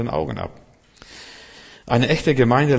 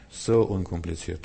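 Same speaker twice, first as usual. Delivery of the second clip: so unkompliziert repeated lower, softer and slow; soft echo; 5 semitones lower, 5 dB quieter than usual. Real, not acted. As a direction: let the words settle gently on the listener. Repeated phrase, softer slower echo.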